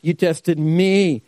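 "You tested me" slow, leaning on "me"; slow and weighty, "me" stressed. Shown as a demonstration. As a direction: land hard on "me" in "You tested me". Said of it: "Here's the word that you need to pay attention to".

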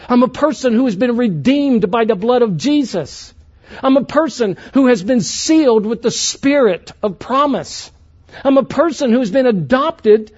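soaring sermon climax; full-force preaching. Acted. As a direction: lift it up like a revival preacher.

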